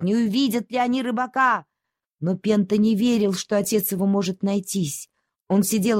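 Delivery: usual speaking voice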